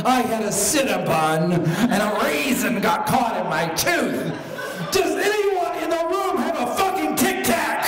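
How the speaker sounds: high, loud, hammed-up yelling; indignant outburst. Accused sugar addict defends herself, frantic. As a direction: build from protest to a shrieked demand.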